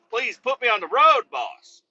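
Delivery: high begging tone; mock pleading. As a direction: plead with the boss half-joking. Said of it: "And I'm like".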